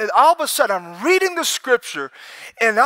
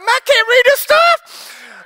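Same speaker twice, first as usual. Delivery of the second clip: much higher, slow, put-on voice of frustration; exasperated mock whine; 13 semitones higher, 4 dB louder, slower than usual. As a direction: whine it like the old words defeat you. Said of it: "I'm like".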